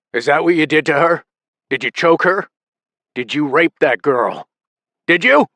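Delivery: loud, rising angry questioning; furious interrogation. A narrator's reading. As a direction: hammer the questions home. Father interrogating son in fury.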